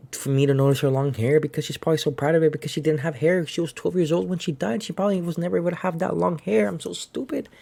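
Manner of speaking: high, fast rush of realisation, imitated; retold self-scolding tumbling out high and quick; self-reproach. Aqua realising why the long hair mattered, high-pitched self-reproach.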